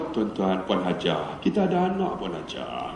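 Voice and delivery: very low, hushed and slow; soft, deep, placating voice; gentle appeal. Acted. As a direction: soothe someone who is cross, barely above a murmur. Gentle answer to the nagging principal.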